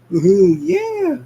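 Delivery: high, loud and slow woohoo; party whoop. Acted. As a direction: stretch out a wild party cheer. Sonic cheering while dancing.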